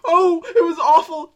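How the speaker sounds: hammed-up wail for sympathy; loud, high mock distress. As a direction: whine to be comforted after a scare. Milking fright for sympathy after scream.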